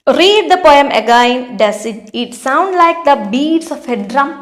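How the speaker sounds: reading the question out very slowly; slow, deliberate classroom read-aloud; slow textbook reading. Acted. Teacher reads textbook questions.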